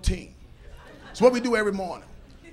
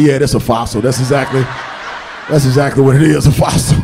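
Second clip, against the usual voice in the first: laughing; 9 semitones lower, 8 dB louder than usual. Retold or imitated. Proudly defending cheap watch.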